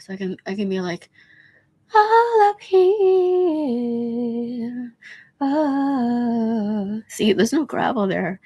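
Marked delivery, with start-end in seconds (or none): 2.0-7.0 high, slow, light falsetto. Shown as a demonstration. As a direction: float it up into head voice. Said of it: "I can sing in that register".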